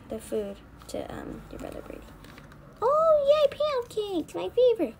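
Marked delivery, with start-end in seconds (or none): 2.8-4.9 high, loud gleeful cheer; delighted squeal. Pretend. Eater gets favorite pancakes, delighted squeal. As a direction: squeal with delight over a treat.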